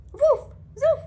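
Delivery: very high, loud yappy woofs; two squeaky puppy barks; puppy barking back. Acted. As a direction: bark back eagerly like a pup.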